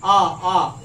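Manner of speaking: loud separate "আ" bursts, jaw dropped; open-mouthed attempt. Shown as a demonstration. Teacher tests university open-mouthed, loud bare "আ". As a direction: try for a "you" sound with mouth open, only "আ" comes out. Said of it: "হাঁ করে ইউ উচ্চারণ করতে পারবো".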